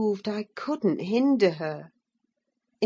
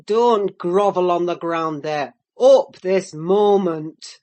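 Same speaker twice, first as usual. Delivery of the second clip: shouting; 8 dB louder than usual. Acted. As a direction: bellow it like a harsh father. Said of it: "he shouted".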